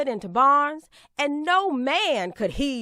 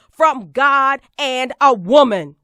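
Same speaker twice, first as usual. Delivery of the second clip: much louder, declared outright; ringing proclamation to the hall; loud triumphant answer; 11 dB louder than usual. Acted. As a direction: slam the answer home.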